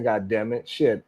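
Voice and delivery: ordinary delivery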